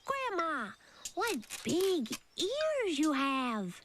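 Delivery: put-on girl staring at the wolf in bed; wondering girl. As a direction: innocent little girl, slowly amazed.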